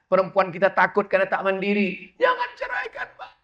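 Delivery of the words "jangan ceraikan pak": pleading wife. Acted. Voicing the abandoned wife's plea.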